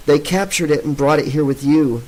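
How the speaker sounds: ordinary delivery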